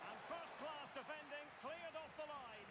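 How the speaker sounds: high, urgent play-by-play, drawn out at the end; excited defensive call. Real, not acted. Commentator calling a goal-line clearance, excited.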